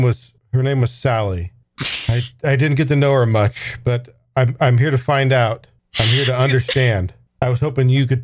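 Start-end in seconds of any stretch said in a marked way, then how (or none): none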